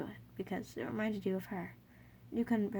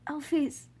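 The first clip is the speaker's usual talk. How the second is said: coughing out words; 3 semitones higher, 4 dB louder than usual. Acted. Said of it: "coughing out the words".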